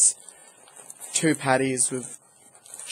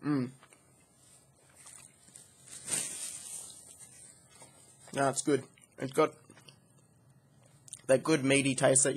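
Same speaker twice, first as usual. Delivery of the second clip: speaking between bites; chewing while talking; 5 dB quieter than usual. Real, not acted. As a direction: mouth partly full of food. Seen 0: Bitten burger before; jaw and cheeks keep working while he speaks.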